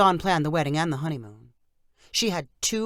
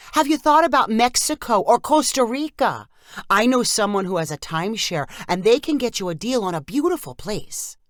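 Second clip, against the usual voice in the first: much higher, louder aunt voice; eager aunt; 5 semitones higher, 5 dB louder than usual. Acted. Aunt suggests.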